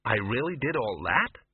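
slow, unsure question; uncertain. A narrator's reading. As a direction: ask it doubting yourself, slowly. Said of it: "said Ginger uncertainly".

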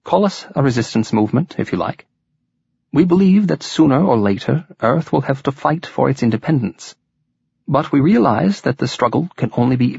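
calm persuasive Welsh leader; lilting Welsh accent. A narrator's reading. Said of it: "in his softly lilting voice".

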